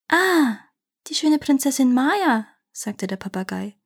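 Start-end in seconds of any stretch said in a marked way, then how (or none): 0.1-2.5 high, admiring bird voice; admiring parrot. A narrator's reading. Parrot praises Princess Maya to the prince, admiring. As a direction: sigh it out in admiration, pitched up.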